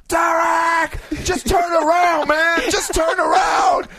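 high, loud, drawn-out yell; hoarse fan calling out to his hero; pleading fan yell. Retold or imitated. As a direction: beg him from the stands to turn. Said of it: "I'm just".

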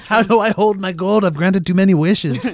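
high-pitched jokey Irish brogue; silly high Irish voice; mock Irish accent. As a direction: play a put-upon leprechaun with an Irish lilt.

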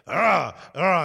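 short grunt of effort; imitated straining grunt. Mimicking a strapped-in player on the leg machine, straining grunt. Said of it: "he's like".

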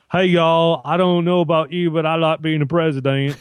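a hint of President Obama, hammed-up; Obama impression. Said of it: "'Cause President Obama's like".

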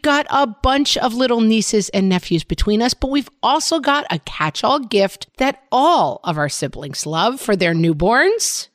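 upbeat sponsor read to a co-host. Acted. Host reading a diaper ad to her co-host, upbeat ad read.

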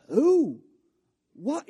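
mock stunned disbelief; shocked king. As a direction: snap out the questions in alarm.

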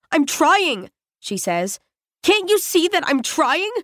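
high, strained frustrated reply; frustrated snap. Acted. Spingate snapping back while struggling with his hands.

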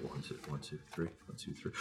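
barely audible level check; Jeff. Retold or imitated. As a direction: count very softly, almost under the breath. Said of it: "then Jeff's like".

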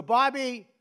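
loud falling calls of Bobby, Bobby, Bobby; mother summoning her son; mom calling out. Retold or imitated. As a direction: call him like a mother from downstairs. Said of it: "she'd go".

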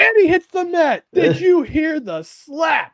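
high, booming ringside play-by-play call; wrestling announcer voice. Pretend. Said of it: "in the wrestling announcer voice".

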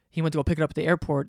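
normal talking voice

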